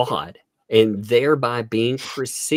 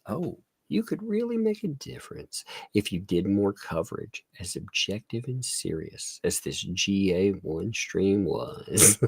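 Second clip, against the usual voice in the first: whispered, mocking sales pitch; 4 dB quieter than usual. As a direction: whisper it like a smarmy recruiter. Mocking the recruiter.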